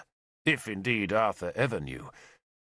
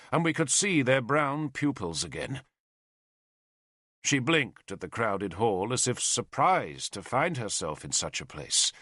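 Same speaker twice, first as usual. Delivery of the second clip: usual speaking voice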